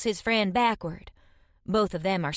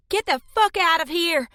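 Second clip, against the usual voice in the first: high, loud enraged scream; furious scream; 9 semitones higher, 5 dB louder than usual. A narrator's reading. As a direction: scream them out of the cave. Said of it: "I scream at them".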